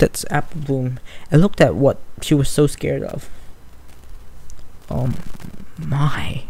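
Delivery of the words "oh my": murmured fear. Acted. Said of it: "Sweetie murmured".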